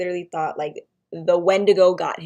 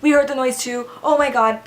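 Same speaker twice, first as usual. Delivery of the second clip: higher, louder imitated panic; freaking out; 5 semitones higher, 5 dB louder than usual. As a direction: imitate a panicked friend on the phone. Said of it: "he's freaking out".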